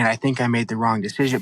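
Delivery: ordinary talk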